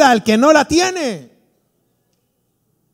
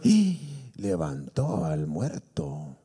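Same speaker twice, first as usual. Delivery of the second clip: slow, much lower, softer awed gasp; amazed gasp; 15 dB quieter, much slower than usual. Retold or imitated. Preacher voices the apostles seeing the dead raised, slow hushed awe. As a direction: let the wonder drag out slowly.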